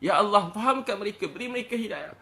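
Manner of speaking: high, loud imploring prayer; pleading prayer. Retold or imitated. Preacher voicing his teacher's night prayer for sinners, pleading.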